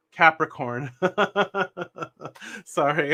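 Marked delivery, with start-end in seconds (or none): 0.9-2.6 high, rapid run of giggles; helpless real laughter climbing in pitch; real laughing fit. Real, not acted. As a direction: burst out in a high chuckle. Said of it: "I'm laughing".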